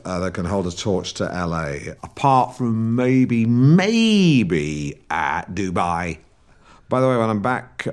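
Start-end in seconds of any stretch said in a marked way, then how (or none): 2.0-6.2 American drawl. Acted. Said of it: "I do pick up the local way of speaking".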